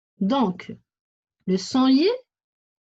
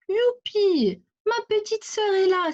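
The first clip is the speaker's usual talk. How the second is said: put-on high-pitched excited cry of a child character; noisy exclamation; 10 semitones higher than usual. A narrator's reading. Hugo greeting his baby sister at home, noisy high-pitched exclamation. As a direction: cry out joyfully, pitched well up. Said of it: "s'écrie bruyamment Hugo".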